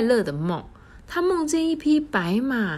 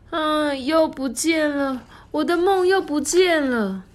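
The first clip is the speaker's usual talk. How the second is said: high, pleading and upset; distressed princess; 10 semitones higher, 3 dB louder than usual. A narrator's reading. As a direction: whimper over the lost dream. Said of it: "满脸憔悴".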